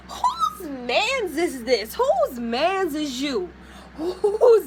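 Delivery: slow, stretched and incredulous; indignant drawn-out question. Real, not acted. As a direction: drag each word out in disbelief. Outraged at his flirting.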